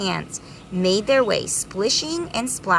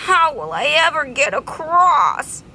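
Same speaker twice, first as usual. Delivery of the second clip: sad bee; 8 semitones higher, 4 dB louder than usual. Acted.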